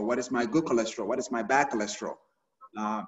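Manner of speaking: ordinary talk, nothing marked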